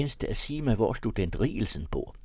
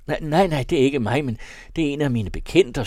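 higher, louder hasty protest; alarmed, raised-voice protest; flustered denial; 6 semitones higher, 6 dB louder, faster than usual. Acted. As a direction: deny it urgently, pitched up. Alarmed denial of the executioner's company.

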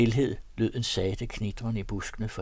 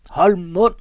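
furious yell, much higher and louder; angry shout; 10 semitones higher, 15 dB louder than usual. A narrator's reading. Valse Peter yells at his coughing companion, furious shout. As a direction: bark it out at full force. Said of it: "råbte Valse Peter vredt".

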